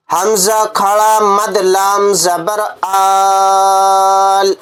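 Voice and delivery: आल drawn out slowly; slow spelling drill. Shown as a demonstration. Teacher spelling for students, slow and stretched. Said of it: "इसकी हिज्जे करने का तरीका".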